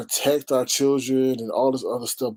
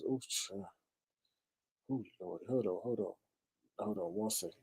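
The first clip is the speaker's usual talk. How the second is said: hushed dismay; 5 semitones lower, 16 dB quieter than usual. Real, not acted. Overwhelmed, muttering softly.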